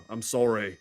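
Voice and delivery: normal talking voice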